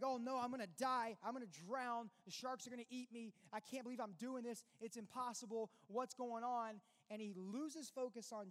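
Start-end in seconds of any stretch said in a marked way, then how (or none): 0.0-6.8 high, loud panicked cry; Peter panicking. Retold or imitated. Peter sinking among waves and sharks, panicked cry. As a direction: act out a frantic man sure he'll drown. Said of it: "And he's like".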